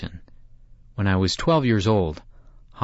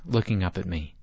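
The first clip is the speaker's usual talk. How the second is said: plain reading voice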